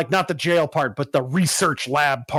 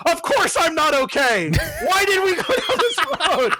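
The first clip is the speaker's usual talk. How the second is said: retold angry outburst, pitched much higher; half-laughing mock yell at dad; mad yelling; 12 semitones higher than usual. Son after the crash, yelling at his dad in mock anger. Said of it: "just yelling".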